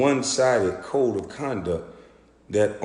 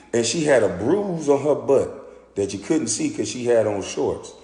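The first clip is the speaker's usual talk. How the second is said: normal talking voice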